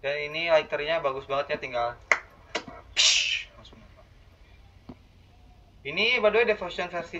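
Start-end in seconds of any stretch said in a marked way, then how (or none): 2.9-3.5 sharp unvoiced "psh"; imitated lighter noise. Shown as a demonstration. Player shows how the lighter lights at once, hissed noise. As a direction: make the hiss of a lighter catching.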